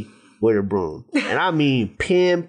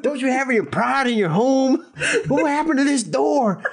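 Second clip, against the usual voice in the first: much higher, put-on indignant homeowner; indignant cook; 11 semitones higher than usual. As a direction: huff about the door like the cook. S1 quotes the cook.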